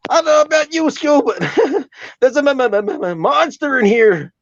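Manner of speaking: high, stammering cartoon slacker; Shaggy impression. Comedian showing an impression to the host, high stammering Shaggy. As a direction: stutter nervously in a very high cartoon voice. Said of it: "Shaggy's a bit more like".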